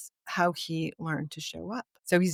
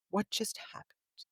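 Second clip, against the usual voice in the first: quiet; 3 semitones higher, 9 dB quieter than usual. Retold or imitated. Gently asking Chuck.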